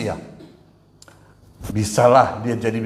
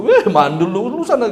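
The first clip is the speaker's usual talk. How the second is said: mocking taunt; 8 semitones higher than usual. Husband mocks his barren first wife, chasing her off with a laughing sneer. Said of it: "kita usir".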